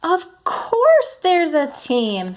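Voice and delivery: high, slow and excited; bright excited reading voice. Shown as a demonstration. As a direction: read it with real excitement. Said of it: "I changed my voice to sound excited".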